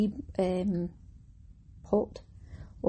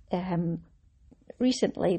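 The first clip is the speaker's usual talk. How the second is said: ordinary talk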